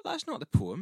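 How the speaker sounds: loud, high-pitched mock outrage; outraged objection. Heckler disputes the poet's line, outraged and high. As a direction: protest as the indignant listener. Acted quarrel with the poet.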